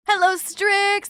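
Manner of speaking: high, loud and slow drawn-out hello; bright drawn-out hello; sing-song greeting. Real, not acted. Greeting viewer.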